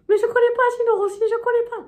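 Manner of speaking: high, bright-eyed shop cashier. Retold or imitated. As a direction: play the chirpy cashier up high. Cashier voiced in retold story. (voix de caissière aiguë)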